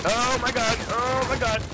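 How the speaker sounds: high-pitched panicked exclamation. Real, not acted. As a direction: draw it out high, in alarm. Enemy suddenly appears in game.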